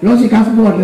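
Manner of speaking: loud, high mock accusation; high, loud, tactless outburst; loud blurting. Shown as a demonstration. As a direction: blurt it out at full volume. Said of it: "nggak, nggak kencang".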